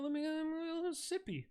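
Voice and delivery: very high, rushed little voice; playful squeaky voice. Acted. Shopper wanting a drink in Costco, playful squeaky voice.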